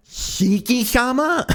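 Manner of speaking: high, slow, rising question; startled disbelief. Acted. Maid finding her master smashing a chair, high astonished query. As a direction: draw the name out high in hammed-up bewilderment. Maid finds him losing it.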